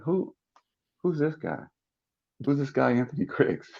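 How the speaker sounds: quietly baffled, retold; puzzled scouts. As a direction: imitate scouts asking under their breath. Scouts puzzled among themselves.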